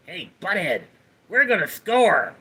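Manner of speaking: cartoon slacker impression; Beavis. Streamer performing a chat request, Beavis impression. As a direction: put on a dopey cartoon teen voice. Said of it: "Love the Beavis impression".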